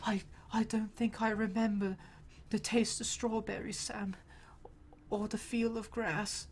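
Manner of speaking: very soft, weakened mock-heroic murmur; mock suffering Frodo. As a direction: barely get it out, hammed-up exhaustion. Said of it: "he's, like, suffering and stuff".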